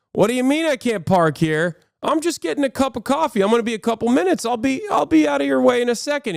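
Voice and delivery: a driver protests to cops, fast, high and whiny; entitled jerk. Retold or imitated. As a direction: play the annoyed jerk who just wants his coffee. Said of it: "he's an asshole".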